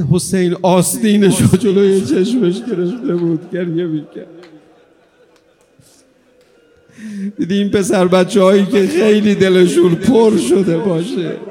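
loud, high mourning sermon; full-force preaching. Acted. Preacher describing Hussein weeping to mourners, loud and high. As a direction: push the grief out to the hall.

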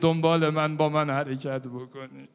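loud impassioned preaching; preaching at full force. Acted. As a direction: call out to the people with fervour. (منبر پرشور با صدای بلند)

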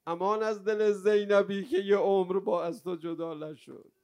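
high, crying eulogy swelling into a loud wail; tearful lament. Real, not acted. Preacher mourning Hussein and Zeinab, tearful lament. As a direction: let grief break through and build to a cry.

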